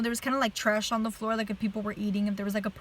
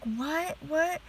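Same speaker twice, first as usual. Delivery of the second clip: retold bewildered questioning, higher pitched; super confused; 5 semitones higher than usual. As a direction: repeat it in raised-pitch disbelief, as a retelling. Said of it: "I was just super confused".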